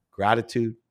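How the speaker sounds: ordinary talk, nothing marked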